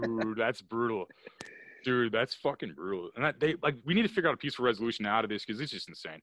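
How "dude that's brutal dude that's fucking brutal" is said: slow drawn-out reaction. Real, not acted. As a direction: stretch out each word, slow and heavy. Reacting to friend's store story.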